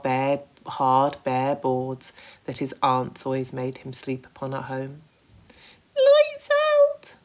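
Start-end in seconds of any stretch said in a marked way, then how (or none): 6.0-7.1 high, loud sleepy centipede voice; drowsy centipede. Acted. Centipede calling lights out from his hammock, drowsy. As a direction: call it out half asleep in the centipede voice. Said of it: "the centipede said drowsily".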